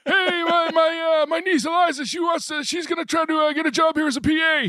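high, far-off voice asking a favour; impression of Tom Gamble. Tom Gamble asking Mitch to get his niece hired, high voice. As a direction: keep the pitch way up as the famous uncle. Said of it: "and then so he was like".

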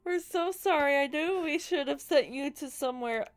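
giggling through the line; can barely hold the laughter; laughing. Real, not acted. Reading dialogue while laughing.

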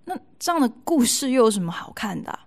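ordinary delivery

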